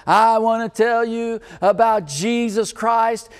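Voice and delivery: slow, loud, high street-preacher call; bold public proclamation. Retold or imitated. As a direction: hand up, announce it boldly to the crowd. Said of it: "put my hand up in the air".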